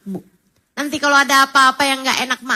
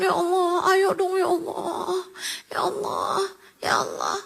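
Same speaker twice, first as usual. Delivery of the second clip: softer, higher, crying plea to God; tearful pleading prayer; 6 semitones higher, 6 dB quieter than usual. Shown as a demonstration. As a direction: beg God through tears. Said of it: "Begitu kalau doa yang serius". (doa memohon sambil menangis, lebih lirih)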